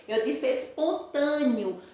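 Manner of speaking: espontâneo said as espontânio, ending in an i sound. Shown as a demonstration. Teacher repeating espontâneo with an I sound for students. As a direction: say espontâneo with the last vowel as I. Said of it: "apareceu o sonzinho do I".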